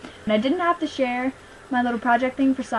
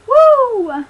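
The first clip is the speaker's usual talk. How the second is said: excited whoop; 9 semitones higher, 9 dB louder than usual. Real, not acted. Showing off her hat.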